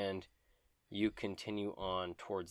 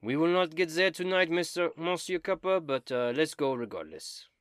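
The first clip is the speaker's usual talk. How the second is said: put-on high, loud French-style accent; strange foreign accent; 8 semitones higher, 7 dB louder than usual. Dobin urges Monsieur Copper onward, in a strange accent. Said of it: "your strange accent".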